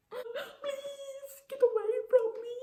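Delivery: tiny, very high-pitched whimper of a plea; squeaky mock plea. Woman silently begging a stranger to leave, squeaky mock plea. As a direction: squeal it like a desperate inner voice.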